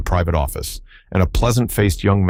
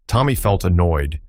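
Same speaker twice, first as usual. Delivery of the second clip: plain reading voice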